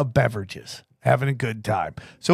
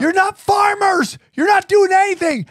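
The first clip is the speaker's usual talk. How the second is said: high, slowed yell; imitating a heckler yelling; mock shout. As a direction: yell the accusation, pitched way up. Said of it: "They're like".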